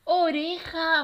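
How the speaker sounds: high, loud, drawn-out "Oreja"; joined-up, triumphant "Oreja"; cheering the answer. Shown as a demonstration. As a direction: celebrate the right answer with the children.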